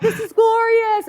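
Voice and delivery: high, loud, elated cry; gleeful exclamation. Retold or imitated. Skipping with butterflies, gleeful and high. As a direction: exclaim it like a delighted child. Voicing her joyful little girl.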